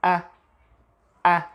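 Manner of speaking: a short neutral "a", like "uh"; schwa sound. Shown as a demonstration. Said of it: "Schwa sound is this sound".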